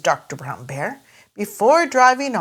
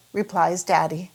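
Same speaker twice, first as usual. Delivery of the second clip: usual speaking voice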